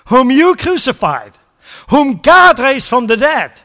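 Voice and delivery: Peter's bold proclamation. Acted. Peter proclaiming before the council, loud and slow. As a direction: declare it boldly to the whole crowd.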